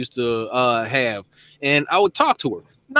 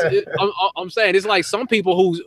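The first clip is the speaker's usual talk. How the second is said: normal talking voice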